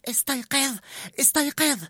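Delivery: very high little rat cry; tiny rat squeaking up at the boy; squeaky rat yelling. A narrator's reading. Remy yelling at Linguini from on top of his head, squeaky. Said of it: "وهو يصرخ".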